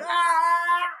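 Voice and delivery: high, sustained mock death scream; long high "aah" scream; demonstrated scream. Shown as a demonstration. Game character eaten by a dinosaur, demonstrated scream. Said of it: "a lot of screams".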